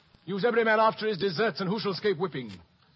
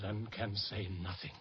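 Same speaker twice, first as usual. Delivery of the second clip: quiet; 8 semitones lower, 8 dB quieter than usual. Acted. Soliloquy sinks.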